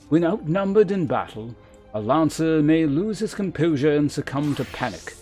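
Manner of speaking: formal, measured mentor with deliberate emphasis; lancer guildmaster. Acted. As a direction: instruct a pupil with grave authority. Voices the lancer guildmaster's dialogue again.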